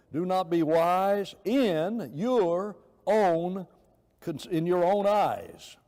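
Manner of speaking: "in your own" drawn out word by word, loud and high; every word emphasized. Shown as a demonstration. Preacher rereading the verse to his class, every word emphasized. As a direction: lean hard and slowly on each word through "eyes". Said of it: "emphasize every word".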